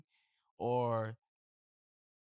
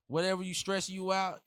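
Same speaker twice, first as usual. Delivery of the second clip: ordinary talk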